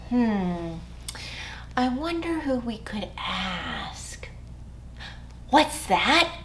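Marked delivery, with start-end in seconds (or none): 1.8-4.3 soft unvoiced whisper. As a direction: wonder aloud in a hush. Storyteller builds suspense for kids.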